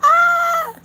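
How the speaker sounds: a sudden high loud squeal of delight; excited shriek. Real, not acted. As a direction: let out a burst of a squeal. Laughing at chat comment.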